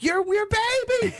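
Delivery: very high-pitched, drawn-out mock scream; upset screaming. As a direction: imitate frantic people screaming after a car. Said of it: "screaming and chasing the car".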